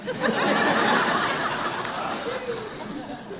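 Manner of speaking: long breathy high laugh; high wheezing laugh. Real, not acted. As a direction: laugh high at your own story. Laughs at own punchline.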